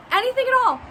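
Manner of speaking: high, loud emphasis on anything at all; emphatic. Real, not acted. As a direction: throw the words up big and loud. Stressing Clary's unlimited wish.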